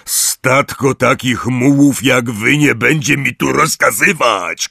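loud, angry Hagrid shout. Acted. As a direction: yell down the mules. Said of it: "krzyknął Hagrid".

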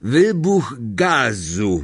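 slow, drawn-out character voice; reply laconically, stretching both words; grunting driver. A narrator's reading. Driver supplies the cover story, slow grunt. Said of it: "mruknął Ern".

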